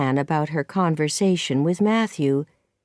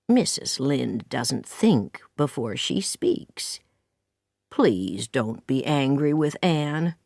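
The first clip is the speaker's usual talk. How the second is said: slow, lower-pitched kindly man; gentle Matthew; 4 semitones lower than usual. Acted. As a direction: plead mildly on the girl's behalf, unhurried. Narrator voices Matthew pleading for Anne.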